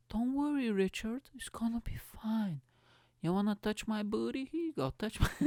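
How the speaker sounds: high, coaxing mock girlfriend voice, partly whispered. Girlfriend reassures Richard before shopping, high coaxing mock voice. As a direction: coo at him high and sweet like a girlfriend.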